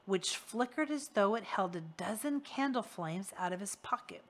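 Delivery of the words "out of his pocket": ordinary delivery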